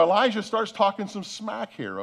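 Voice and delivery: ordinary talk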